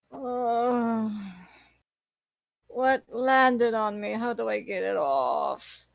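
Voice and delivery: low, slow, bewildered stranger asking; puzzled voice of a waking figure; confused NPC voice. Acted. NPC voice waking up, far timbre.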